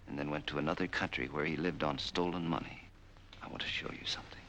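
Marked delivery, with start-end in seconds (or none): 3.4-4.4 soft, threatening whisper; menacing whisper. Acted. Captor to his prisoner, hushed and menacing. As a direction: lean in and hush it like a threat.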